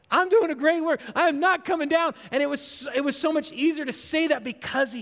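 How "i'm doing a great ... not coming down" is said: higher and bold, a sure-footed refusal; confident declaration. Retold or imitated. Nehemiah on the wall with his people behind him, bold confident declaration. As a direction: say it like you have people beside you. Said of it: "he was able to say it with such confidence".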